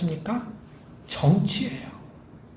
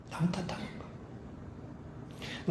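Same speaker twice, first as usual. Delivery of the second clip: whispered repeat; 3 semitones lower, 20 dB quieter, much slower than usual. Shown as a demonstration. Preacher to congregation, hushing the key point in a slow whisper. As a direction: whisper the phrase back, slow and hushed.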